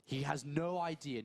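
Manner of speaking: usual speaking voice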